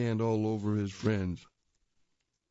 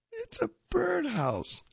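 much higher, drawn-out character line; high slow cartoon voice. Announcing the birdhouse, high slow cartoon voice.

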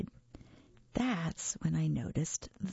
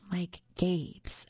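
whisper. Acted. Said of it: "I thought".

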